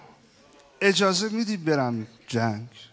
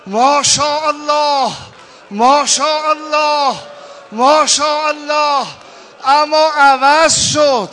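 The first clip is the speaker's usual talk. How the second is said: loud, high, wailing sermon; full-force preaching; 9 semitones higher, 11 dB louder than usual. Acted. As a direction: cry out the battle scene. Preaching battle scene at full force.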